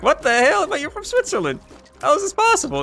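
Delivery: high, rising disbelief; retold disbelief. Retelling meeting a Swiss guy online, stunned high disbelief. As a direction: act out his own stunned confusion.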